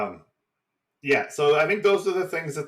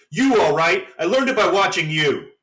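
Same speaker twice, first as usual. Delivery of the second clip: loud, higher-pitched PSA melodrama; hammed-up eighties anti-drug ad retort; mock kid outburst; 7 semitones higher, 8 dB louder than usual. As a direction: yell back like a kid accusing dad. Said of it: "And the kid goes".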